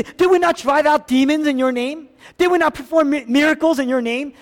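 high, loud protest; emotional pleading. Acted. Rejected worshippers defending their works to Jesus, pleading loudly. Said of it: "That's emotional language".

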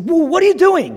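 loud, high-pitched outburst of protest; indignant interruption. Acted. Joseph interrupting his father Jacob, indignant. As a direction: cut in sharply, voice leaping up. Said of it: "he's interrupting".

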